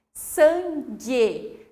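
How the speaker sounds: sangue with a hard g, no u sound; silent U. Shown as a demonstration. Teacher modelling sangue for learners, U left silent. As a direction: say sangue as san-ghe, slightly punched. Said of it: "eu não falo sangue".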